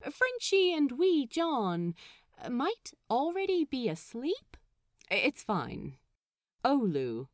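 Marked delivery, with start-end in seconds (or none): none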